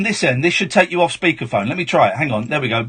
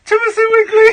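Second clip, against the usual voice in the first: laughing; faster than usual. Real, not acted. Host cracking up at caller.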